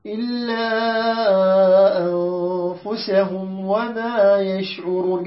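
slow measured tajweed chant; Quran recitation. Shown as a demonstration. Teacher recites the verse to the class in slow tajweed. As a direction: draw each word out like tilawa.

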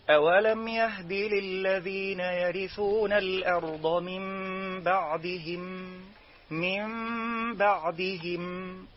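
chanted Quran recitation, sung slowly. Shown as a demonstration. Teacher reciting the iqlab example, chanted slowly. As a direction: chant it with the nasal m before b. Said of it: "نون ساكنة وبعدها باء".

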